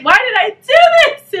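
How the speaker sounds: high, loud retold wail; mock anguish. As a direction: cry out in exaggerated self-reproach. Acting out her regretful panic.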